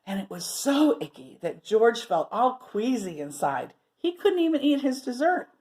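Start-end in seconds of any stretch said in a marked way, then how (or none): none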